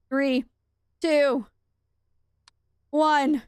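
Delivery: tense countdown. Real, not acted.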